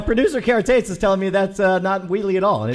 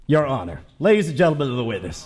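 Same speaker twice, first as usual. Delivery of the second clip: Southern lawyer voice; 6 semitones lower than usual. Acted. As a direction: plead to the court with a Southern lawyer accent. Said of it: "you're gonna go to the Southern Lawyer this early?".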